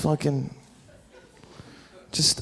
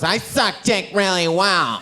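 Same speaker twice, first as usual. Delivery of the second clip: mock girl's boast; 3 semitones higher, slower than usual. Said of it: "and one girl goes".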